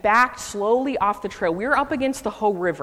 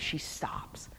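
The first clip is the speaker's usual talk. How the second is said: whisper; 13 dB quieter than usual. Real, not acted. Tense moment facing the bear.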